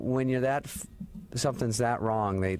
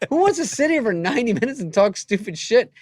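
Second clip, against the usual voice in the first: high, loud retold blow-up; comic outrage at full volume; exasperated rant; 11 semitones higher, 10 dB louder than usual. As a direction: let loose at a friend, hammed up. Retold angry comeback to Norm.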